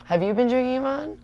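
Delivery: high, mocking turn-back question. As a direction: toss the accusation back, teasing and high.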